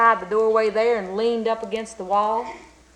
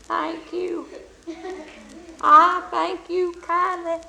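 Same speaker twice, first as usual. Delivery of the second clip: put-on high, thin, slow old man's voice; old beggar thanking the blacksmith; feeble old beggar; 7 semitones higher, slower than usual. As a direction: quaver it like a frail old beggar. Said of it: "said that old beggar man".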